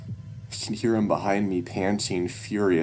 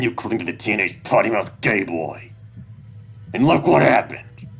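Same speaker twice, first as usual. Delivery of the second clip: loud, higher angry scolding; angry lecture; 5 semitones higher, 9 dB louder than usual. Acted. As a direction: berate your son. Dad berating son about Flynn.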